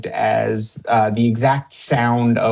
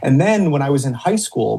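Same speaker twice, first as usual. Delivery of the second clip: ordinary talk, nothing marked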